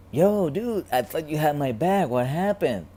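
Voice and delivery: high, loud, dude-like protest; aggrieved complaint. Retold or imitated. Man in heaven complaining to God, aggrieved. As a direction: call God out like a let-down buddy. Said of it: "he asks God".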